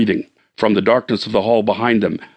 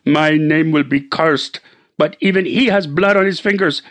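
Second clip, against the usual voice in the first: lightly voiced Ramagani; 6 semitones higher than usual. Indian confesses to husband, lightly voiced bitter. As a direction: confess with grim pride.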